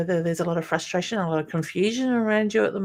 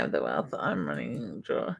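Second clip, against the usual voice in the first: low, soft mumble to herself; reading the comment under her breath; half-voiced murmur while reading; muttered reading; 8 dB quieter than usual. Real, not acted.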